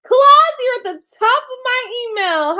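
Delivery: loud, high-pitched delight; excited. Real, not acted. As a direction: exclaim it in triumph. Thrilled the email arrived.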